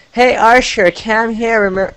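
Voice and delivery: high, loud put-on voice reading his email; mock Cam voice. Host reading a critic's email aloud, in a high put-on voice. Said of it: "anyways, I'll read it".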